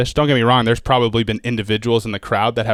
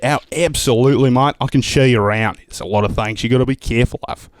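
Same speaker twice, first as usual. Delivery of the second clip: put-on Australian accent; 2 semitones higher than usual. Comic warning the host about snakes in an Aussie accent. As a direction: play the cheery Australian guide. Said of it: "accents are kind of my deal".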